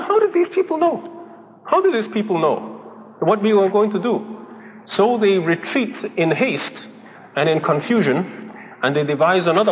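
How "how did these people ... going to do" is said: baffled Syrians. Retold or imitated. Syrian officers baffled by Elisha.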